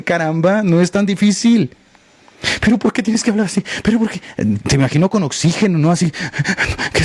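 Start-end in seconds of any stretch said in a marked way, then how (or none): 2.6-4.2 queja burlona, voz más aguda y ajena; crítico quejoso imitado. Retold or imitated. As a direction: imitar con sorna al que se queja del tono. (burla aguda del crítico que se queja)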